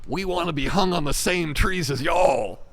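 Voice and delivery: joking protest chant, thrown up high and loud; mock protest shout. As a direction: shout it like an outraged crowd demanding rights. Mock protester demanding equal hanging.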